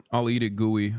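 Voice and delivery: ordinary talk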